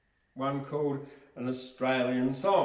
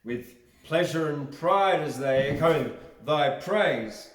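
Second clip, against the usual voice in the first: poem recitation; 3 semitones higher, 8 dB louder than usual. Acted. Reads a patriotic poem aloud.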